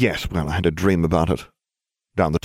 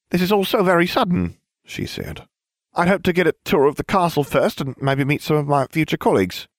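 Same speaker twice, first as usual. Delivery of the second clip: very high, quick, airheaded voice; squeaky airhead voice; ditzy blonde witch; 10 semitones higher than usual. A narrator's reading. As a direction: play the dim bubbly witch, pitched way up.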